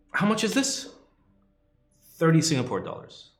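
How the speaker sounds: high, quick Singlish haggling voice; a light Singlish accent asking price. Pretend. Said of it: "Please do Singlish accents".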